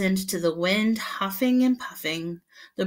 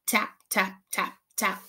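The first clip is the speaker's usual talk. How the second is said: tapping sound. A narrator's reading.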